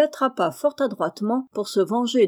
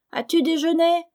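high squawky parrot voice, much higher; funny parrot cry; 9 semitones higher, 3 dB louder than usual. A narrator's reading. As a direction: screech it like a comic parrot. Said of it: "cria d'un air si drôle".

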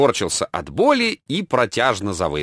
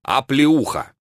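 usual speaking voice

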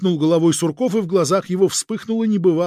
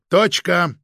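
higher, louder, final word; firm; 4 semitones higher, 6 dB louder than usual. A narrator's reading. Chief cuts off the agent's protest, firm and final. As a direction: shut the argument down decisively. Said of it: "твёрдо сказал Фома Фомич".

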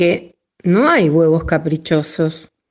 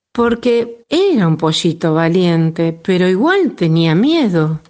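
usual speaking voice

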